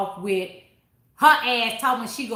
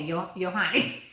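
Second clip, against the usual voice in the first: normal talking voice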